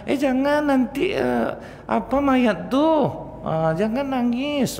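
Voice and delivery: high, slow scolding busybody voice; mock a fussy neighbour hushing the mourner; fussy bystander. People forbidding mourners to cry. (suara tinggi dan lambat, meniru orang yang melarang menangis)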